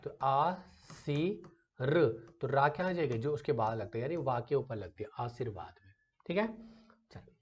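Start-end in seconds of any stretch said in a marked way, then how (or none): 0.0-2.0 आ सी र spelled out slowly, syllable by syllable. Shown as a demonstration. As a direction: break आशीर्वाद into आ सी र, slow. (आ सी र धीरे-धीरे अक्षर तोड़कर)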